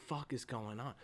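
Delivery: low, soft nervous murmur; whisper-soft, as if the door just creaked; hushed fright. Retold or imitated. Viewer as the door cracks open, soft and nervous. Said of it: "you're like".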